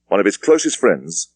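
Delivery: ordinary delivery, nothing marked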